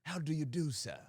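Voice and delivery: mock-polite British manager; British accent. Nigel the manager greeting a customer, mock British accent. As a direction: put on a proper British accent. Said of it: "Then a Nigel comes out, and he's like".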